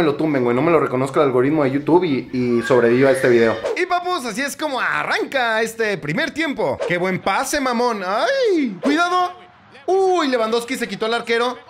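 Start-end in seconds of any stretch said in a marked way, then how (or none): 3.8-11.6 high, eager live reaction to play; excited match reaction. Real, not acted. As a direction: call every pass like it matters. Reacciona al partido en vivo. (reacción emocionada al partido)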